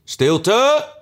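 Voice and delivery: high-pitched herald's cry; loud call. A narrator's reading. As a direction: call out for silence at the top of the range. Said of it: "het witte konijn riep luid".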